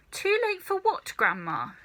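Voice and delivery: put-on puzzled little boy. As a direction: ask it like a puzzled child. George asks, puzzled.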